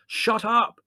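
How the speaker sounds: high, loud bark; sudden shouted order; menacing bellow. A narrator's reading. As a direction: bellow it as a threat. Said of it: "bellow ... in menacing tones".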